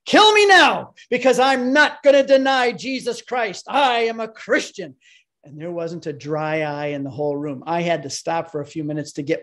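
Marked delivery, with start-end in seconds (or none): 0.1-4.9 impassioned retold yell, much higher and louder; yelled at the top of his voice. Student lying on the stage defying the crowd, yelling. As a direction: cry out ready to die for the faith. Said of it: "he yelled at the top of his voice".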